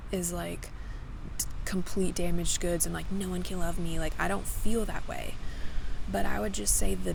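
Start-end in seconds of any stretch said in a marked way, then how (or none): none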